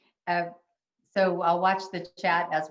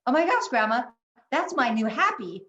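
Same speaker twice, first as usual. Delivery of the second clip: put-on louder and higher, a child's sudden joy; sudden delight; 6 semitones higher, 5 dB louder than usual. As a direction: burst out like a thrilled little boy. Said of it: "all of a sudden he was like".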